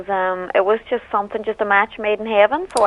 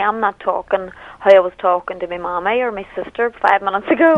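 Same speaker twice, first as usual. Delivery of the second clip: normal talking voice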